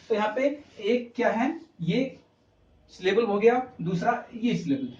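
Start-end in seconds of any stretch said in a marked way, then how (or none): none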